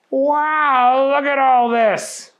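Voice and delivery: high, loud amazed gasp of wonder; wide-eyed wow. A narrator's reading. Booger marvels at the studio kitchens, high and amazed.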